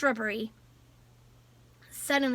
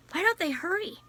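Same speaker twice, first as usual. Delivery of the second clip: a higher, worried first asking with a rise at the end; higher anxious question rising at the end; 6 semitones higher, faster than usual. A narrator's reading. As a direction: ask it fretting, higher, with hurry still hanging. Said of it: "muttered Jem".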